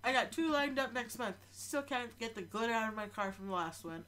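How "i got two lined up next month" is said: ordinary talk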